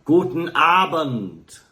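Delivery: slow German "Guten Abend" from a crowd; drawn out like a whole hall answering; German crowd greeting. Retold or imitated. Crowd answering the singer, slow German greeting. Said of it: "everyone together will just go".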